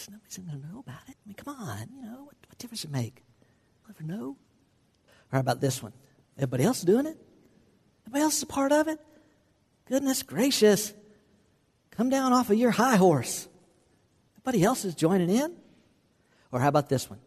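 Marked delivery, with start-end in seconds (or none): 0.1-4.4 fast, hushed whisper like sharing a secret; sneaky tempter whispering; conspiratorial whisper. Acted. 6.4-15.6 higher, sneering peer-pressure voice; hammed-up scornful friend; mocking scoffing voice.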